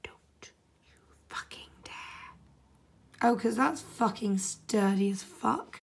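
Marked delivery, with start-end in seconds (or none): 0.0-2.3 slow threatening whisper, much softer; barely audible whisper. Real, not acted. Builder threatens a loose piece, barely audible whisper. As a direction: breathe the warning at the pieces.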